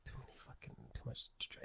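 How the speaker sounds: breathy under-the-breath mutter; whispered aside. Real, not acted. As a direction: mutter it to yourself.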